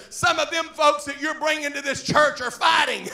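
high, loud usher sounding the alarm; imitated usher's loud complaint. Alarmed usher reporting a fight.

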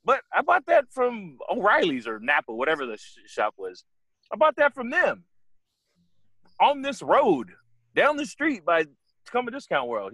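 high-pitched protesting disbelief; mock incredulous. Driver tells cop where he bought it, acted high-pitched incredulity. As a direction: plead surprise, voice pitched well up. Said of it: "This is exactly how I'm talking about".

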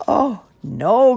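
loud, high groan of dismay. A narrator's reading. Jerome falling for a second prank, groaning. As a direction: groan it out in big exasperation. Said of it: "groaned Jerome".

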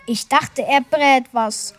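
usual speaking voice

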